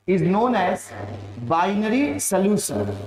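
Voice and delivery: slow, measured dictation. Real, not acted. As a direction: spell out the phrase word by word. Finishes dictating the definition.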